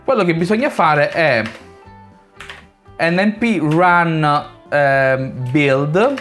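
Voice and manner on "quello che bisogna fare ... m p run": ordinary talk, nothing marked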